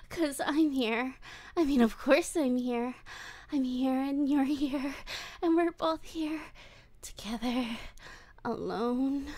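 creepy, giddy yandere voice, swinging between coos and hushed whispers; obsessive captor. Acted. Captor coos at tied-up Wolfie, creepy and giddy. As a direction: coo over your helpless captive like a lovesick stalker.

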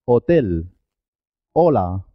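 "Hotel, hola" starting straight on the vowel o; silent H. Shown as a demonstration. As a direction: drop the H entirely in "Hotel, hola". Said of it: "no tiene sonido".

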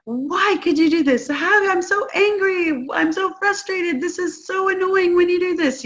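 put-on high, exasperated parent scolding their kid; mock angry yelling. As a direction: rant at the child, frustrated and fed up, pitched high. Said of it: "you are yelling at them".

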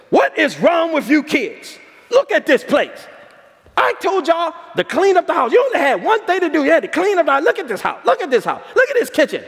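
high, scolding mother's rant; scolding mom. Retold or imitated. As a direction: tear into the kids like an angry mom over a dirty house. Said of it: "she says".

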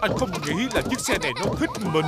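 high, fast, laughing complaint. Acted. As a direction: giggle through the words, fast. Car sprays Daddy Pig.

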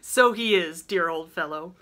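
loud, hearty agreement. Acted. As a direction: praise him with gusto. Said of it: "said Laurie heartily".

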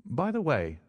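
sudden question. A narrator's reading. Watson stops and turns on Stamford, sudden query. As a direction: break in with a lifted afterthought. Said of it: "I asked suddenly".

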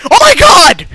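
high, explosive yell; very loud shout. Real, not acted. Gamer startled mid-game, very loud shout. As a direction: blurt it at full volume in shock. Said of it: "Oh my god, that was loud".

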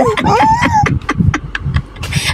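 squealing very high laugh; shrieking laugh. Real, not acted. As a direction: squeal in delight. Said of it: "Sunod-sunod". (matinis na tawa)

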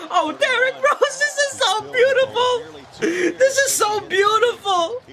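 very high, slow, wailing delight; real fan swooning in a squeal; overjoyed gushing. Real, not acted. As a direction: draw it out as if weeping with joy. Overjoyed fan watching his favorite.

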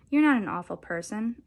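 ordinary delivery, nothing marked

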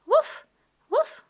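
high, loud puppy woof; puppy bark. Acted. Voicing the puppy Biscuit barking.

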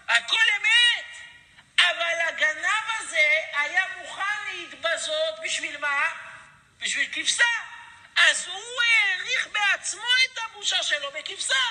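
high, loud, slow preacher's cry; fiery preaching. Acted. Preacher crying out the rabbi's words to the congregation, shouting. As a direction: thunder it out like a rebbe pleading.

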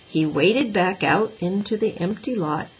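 ordinary delivery, nothing marked

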